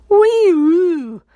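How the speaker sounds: a failed whinny that sounds like a sick cow. A narrator's reading. Boy trying to copy his friend, clumsy whinny. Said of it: "Thomas's sounded more like a sick cow".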